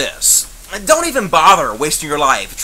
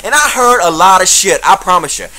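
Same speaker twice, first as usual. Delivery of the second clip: ordinary talk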